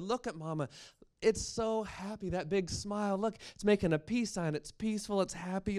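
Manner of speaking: put-on high, delighted young girl; excited girl. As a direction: gush over the doll. Girl excited about doll.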